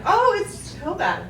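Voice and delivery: normal talking voice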